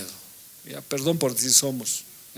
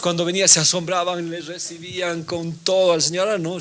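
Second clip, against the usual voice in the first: raised, louder preaching voice; 5 semitones higher, 6 dB louder than usual. Acted. Preacher to congregation about Eden, rising preaching voice. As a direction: drive the point home to the congregation.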